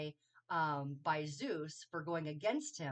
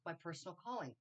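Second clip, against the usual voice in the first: ordinary delivery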